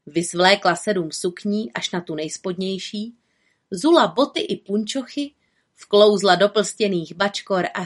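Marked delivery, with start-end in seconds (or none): none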